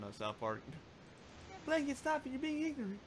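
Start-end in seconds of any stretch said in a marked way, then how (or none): 1.7-3.0 high, loud scolding impression. Retelling the South Park scene, high scolding impression. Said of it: "quote unquote [name]".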